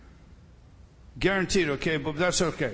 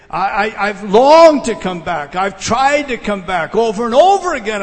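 impassioned longing; 9 dB louder than usual. Acted. Preacher voicing Paul's longing.

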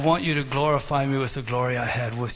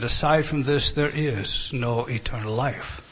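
ordinary delivery

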